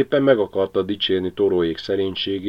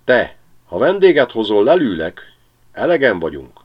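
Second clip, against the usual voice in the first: threatening shout; 4 semitones higher, 7 dB louder than usual. Acted. Pilátus threatens the magpie.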